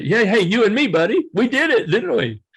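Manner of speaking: high, elated mock greeting to a buddy; jubilant imagined celebration. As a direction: celebrate the shared win, ending in laughter. Imagines celebrating with his other self.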